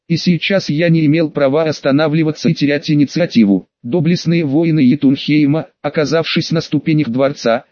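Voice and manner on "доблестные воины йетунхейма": ordinary delivery, nothing marked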